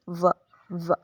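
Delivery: व said very light, with no force; light v sound. Shown as a demonstration. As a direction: breathe व out gently, don't push it. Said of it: "लेकिन ये बिल्कुल light sound है".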